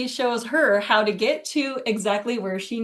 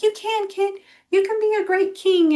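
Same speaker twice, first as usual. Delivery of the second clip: much higher, sugary-sweet cheerleading; mock gushing encouragement; 9 semitones higher than usual. Friend retelling a book, mocking the heroine's praise. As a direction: lay the flattery on thick, mockingly. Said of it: "She's like".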